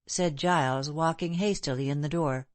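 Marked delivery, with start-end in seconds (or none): none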